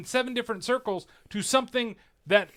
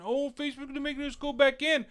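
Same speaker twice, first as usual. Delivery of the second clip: high-pitched imitation of complainers; mock bitching; 8 semitones higher than usual. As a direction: mimic whiners in a higher put-on voice. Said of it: "kinda bitching".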